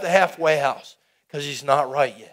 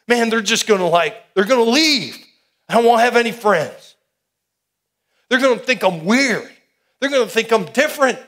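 higher, louder worried pleading, retold; anxious protest; 5 semitones higher, 4 dB louder than usual. Preacher recalls fearing friends would leave, anxious higher pleading. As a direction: replay the panic of losing your friends. Retelling fear of losing friends.

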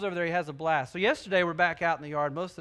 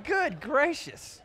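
exasperated amusement; 8 semitones higher, much slower than usual. Real, not acted.

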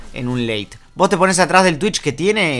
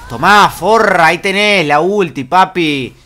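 loud triumphant gloating; gloating taunt; 3 semitones higher, 7 dB louder, slower than usual. Real, not acted. Player loudly gloating at the enemy after landing the ultimate. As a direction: rub the kill in her face.

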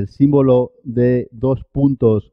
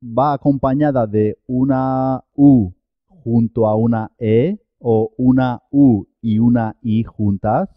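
ordinary delivery

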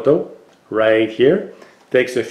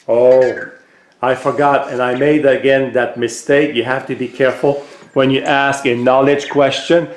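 normal talking voice

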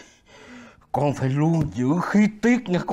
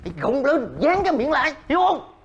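scolding; 9 semitones higher, faster than usual. Acted. Scolding the grandson harshly.

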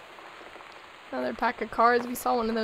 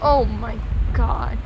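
startled; 2 semitones lower, 8 dB louder, slower than usual. Real, not acted. Startled by a jump scare.

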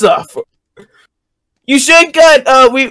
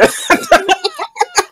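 high laugh; 11 semitones higher, 4 dB quieter than usual. Real, not acted. Laughs after giving up.

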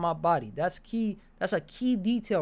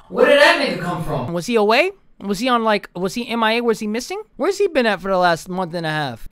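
outraged rant; 2 semitones higher, 7 dB louder than usual. Real, not acted. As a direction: rant at the chat, demanding answers. Ranting about her hidden boyfriend.

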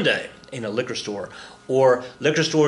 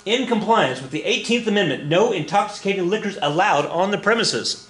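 lightly put-on, higher official voice; mock official proclamation; 6 semitones higher than usual. Cops citing the Amendment while drinking, mock official proclamation.

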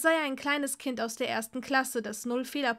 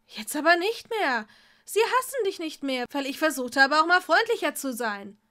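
loud, much higher angry cry; angry shout; 4 semitones higher, 8 dB louder than usual. Acted. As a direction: shout back defiantly. Tom yells back at Draco.

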